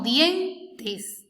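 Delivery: dientes slow, with ie kept together; correct diptongo. Shown as a demonstration. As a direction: show dientes the right way, slowly. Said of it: "decimos dientes". (dientes despacio, con el diptongo ie junto)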